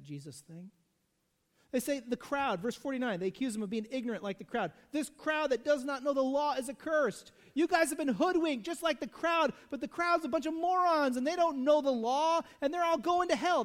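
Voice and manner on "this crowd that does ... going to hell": high, loud ranting contempt; mock scorn. Preacher acting out Pharisees damning the crowd, loud and high. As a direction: rant like a sneering Pharisee.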